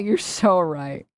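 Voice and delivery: slow, drawn-out mock agreement; slow teasing sarcasm; slow sarcastic agreement. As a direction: concede with heavy irony. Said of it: "You know what?".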